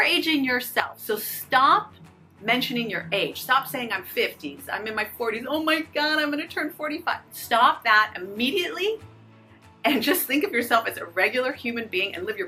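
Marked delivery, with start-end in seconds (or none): none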